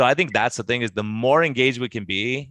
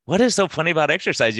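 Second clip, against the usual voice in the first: baffled question; 6 semitones higher, 4 dB louder than usual. Retold or imitated. Acting out his baffled question to patients.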